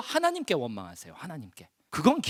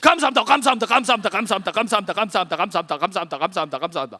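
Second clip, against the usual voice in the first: rapid-fire thanks; 5 dB louder, much faster than usual. Shown as a demonstration. Preacher demonstrating the second set of thanks, rapid descending chant. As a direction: rattle it off until the voice runs down. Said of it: "원 세트 피니시".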